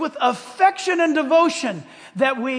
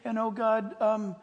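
soft mock prayer drifting off; mock distracted pray-er, soft and sliding away; 8 dB quieter than usual. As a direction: pray softly, then wander to a sale. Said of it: "then you go to prayer".

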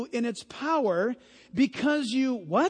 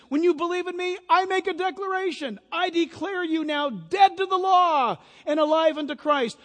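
higher, building to a loud proclamation; booming declaration; 6 semitones higher, 4 dB louder than usual. Acted. Preacher voices God declaring believers dead to law, emphatic declaration. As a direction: proclaim it as God's ruling.

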